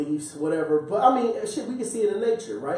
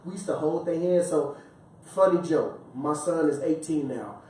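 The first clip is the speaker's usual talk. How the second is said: ordinary talk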